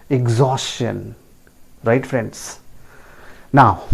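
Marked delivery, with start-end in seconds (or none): 0.1-1.0 exhaustion repeated as ig-ZAWS-chun; correct pronunciation. Shown as a demonstration. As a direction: clear CH in exhaustion. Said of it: "repeat that". (exhaustion का सही उच्चारण, इग-ज़ॉस-चन)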